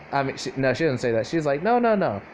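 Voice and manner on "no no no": higher-pitched protest; acted insistent objection. She presses him about what that was, higher insistent objection. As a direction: push back on a raised pitch.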